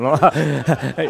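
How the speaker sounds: a quick real chuckle; short laugh. Real, not acted. Amused at his watching colleagues, a quick laugh. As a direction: laugh briefly at the memory.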